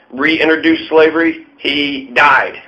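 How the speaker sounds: ordinary talk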